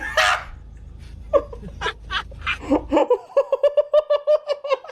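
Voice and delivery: high, cackling laugh; helpless laughter. Real, not acted. Streamer reacting to a video, high helpless laughter. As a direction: burst into a long high cackle.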